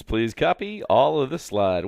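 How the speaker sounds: usual speaking voice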